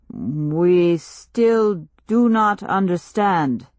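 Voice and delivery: slow, grave stranger voice; slow solemn chief. Acted. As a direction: speak slowly like a village elder.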